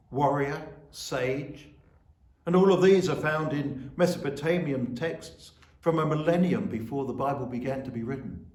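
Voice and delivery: normal talking voice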